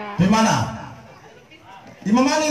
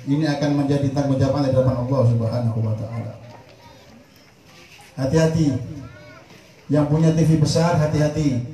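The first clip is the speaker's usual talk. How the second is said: ordinary talk, nothing marked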